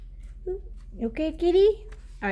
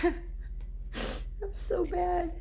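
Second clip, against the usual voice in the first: laughing; 10 semitones higher, 6 dB quieter, much slower than usual. Real, not acted. Laughing at herself.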